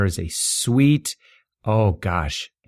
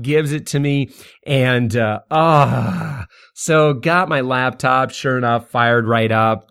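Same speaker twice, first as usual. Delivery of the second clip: ordinary talk, nothing marked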